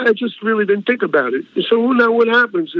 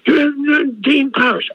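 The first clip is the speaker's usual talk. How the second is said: lightly imitated show announcer, pitched up; higher-pitched mock introduction; stage announcer; 7 semitones higher, slower than usual. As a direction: announce the act to a crowd. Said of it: "they announced me".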